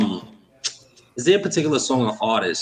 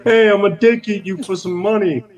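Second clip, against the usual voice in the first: higher, louder hanger-on imitation; mocking a hanger-on; 7 semitones higher, 4 dB louder than usual. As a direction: play the greedy hanger-on.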